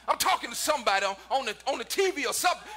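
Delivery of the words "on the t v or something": ordinary talk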